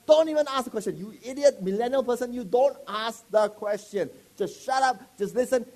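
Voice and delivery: loud, high mock rebuke; mock scolding. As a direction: playfully bark at the listener.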